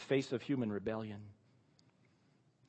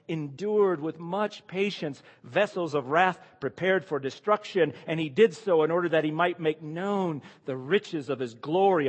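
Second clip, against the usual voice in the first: raised, measured Bible reading voice; scripture reading; 3 semitones higher, 5 dB louder than usual. Acted. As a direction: read the passage out to the congregation. Reads scripture passage aloud.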